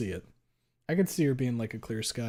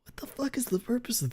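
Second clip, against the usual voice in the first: higher, hushed, baffled whisper; incredulous higher whisper; 11 semitones higher than usual. Retold or imitated. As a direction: whisper the disbelief to yourself. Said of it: "I'm sitting there like".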